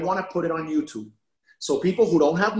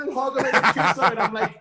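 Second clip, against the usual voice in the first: laughing; 3 semitones higher, faster than usual. Real, not acted. Laughing while telling it.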